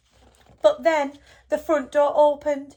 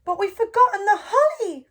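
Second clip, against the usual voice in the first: high, louder little mouse exclaiming; excited cry; 8 semitones higher, 4 dB louder than usual. A narrator's reading. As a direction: blurt it out in sudden alarm. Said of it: "cried Little Mouse".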